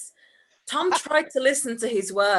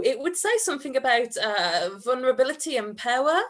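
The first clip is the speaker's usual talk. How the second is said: fast, gabbled justification; rushed excuse; 3 semitones higher, faster than usual. Acted. Tom hurrying his excuse to Andrew, rushed. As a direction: rattle off the excuse quickly.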